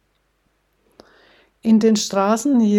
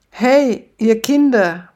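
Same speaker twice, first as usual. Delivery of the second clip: put-on barking at kids; 3 semitones higher, 6 dB louder than usual. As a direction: bark them off the beach. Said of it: "bellt er sie an".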